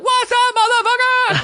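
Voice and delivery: very high, loud mock bro shout; hammed-up bro greeting. Faking friendship with a comedian at a party. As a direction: overdo it like an old buddy. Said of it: "I blacked it up".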